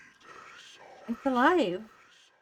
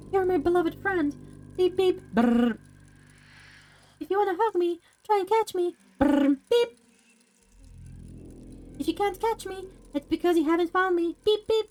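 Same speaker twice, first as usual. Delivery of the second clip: higher, playful toy voice with beeps; toy robot voice; 5 semitones higher than usual. Acted. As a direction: chirp it like a little wind-up robot.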